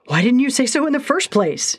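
high eager exclamation; excited exclaim. Acted. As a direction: burst out, delighted. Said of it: "he exclaimed".

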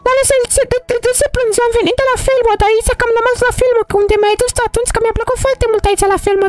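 put-on high, worried little girl asking why he cries; a young girl pleading with a crying friend; girl character voice. Mara asks crying Darusel what's wrong, high worried voice.

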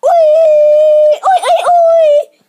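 high, loud playful yell of oi; goofy shout. Acted. Kid yelling the catchphrase at the camera, loud and high. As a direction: belt out the catchphrase at the top of your lungs.

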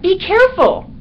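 high, loud urgent warning; urgent cry of caution; alarmed warning. A narrator's reading. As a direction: warn sharply, pitch leaping up. Dog warns bat on sleeper's head.

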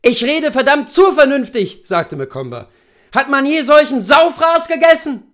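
loud, high furious complaint; angry rant. Acted. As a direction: lash out at everyone at the table. Husband rants at the table.

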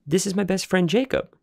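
put-on high, eager boy voice; boyish introduction. Boy presenting his friend to the dog, high and eager. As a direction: introduce your friend to the beast.

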